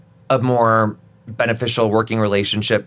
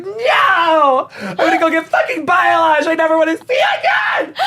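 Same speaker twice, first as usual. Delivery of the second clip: high, loud hammed-up shouting with a fake sob; mock tantrum; 3 dB louder than usual. To her shampoo and conditioner in the shower, a high, loud hammed-up sobbing shout. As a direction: wail it like a heartbroken drama queen. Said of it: "look at my shampoo ... and be like".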